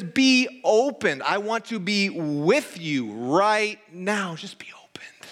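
high, loud, slow plea fading to a whisper; Jesus. Retold or imitated. Preacher voicing Jesus's call to the congregation, impassioned then hushed. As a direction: cry it out drawn out, then drop to a whisper. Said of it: "He says".

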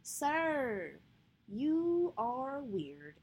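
retold brush-off. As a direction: drag it out, unimpressed and mocking.

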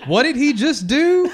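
loud, high, laughing disbelief; hammed-up shock, laughing; loud mock outrage. Pretending to discover weirdo.

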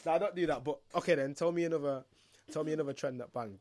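ordinary delivery

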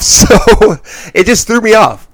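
can't stop laughing at the wrestling show. Real, not acted. Host laughing at the sudden beatdown, laughing while speaking. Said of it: "That's why I'm laughing".

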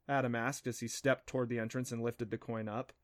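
plain reading voice

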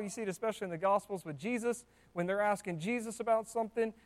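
ordinary talk

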